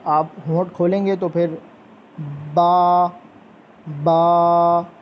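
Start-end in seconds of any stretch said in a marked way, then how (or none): 2.5-4.9 बा louder, then drawn out slowly; correct ba. Shown as a demonstration. Teacher modeling बा for children, the second one drawn out slowly. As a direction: burst then hold बा. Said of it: "ऐसे".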